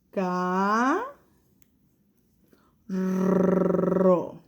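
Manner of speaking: "Ca-" then "rro" split by a long pause; teacher splits "carro" into its syllables. Shown as a demonstration. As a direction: clap out the syllables. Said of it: "cuántas sílabas".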